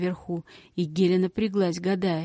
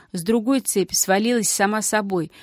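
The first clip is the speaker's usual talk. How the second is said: plain reading voice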